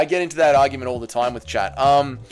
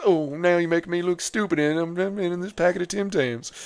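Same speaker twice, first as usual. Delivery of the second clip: lightly voiced sulky Derek Lewis; mock embarrassed grumble; Derek Lewis impression; 2 semitones higher, 5 dB quieter than usual. Streamer voicing Derek Lewis stuck in the packet, light sulky voice. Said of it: "and Derek Lewis".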